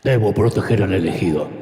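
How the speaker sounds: low, robotic movie-robot voice; Terminator. Pretend. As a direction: play the cyborg. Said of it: "llegó como Terminator". (voz grave de robot de película, el Terminator)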